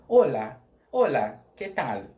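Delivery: loud Spanish olá, que tal; Spanish greeting demo. Shown as a demonstration. Teacher repeats the Spanish greeting, loud. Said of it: "a gente fala".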